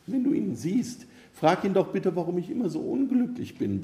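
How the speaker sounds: high-pitched pleading damsel; pleading young woman. A narrator's reading. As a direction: beg in a put-on high female voice. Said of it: "bat die junge Frau".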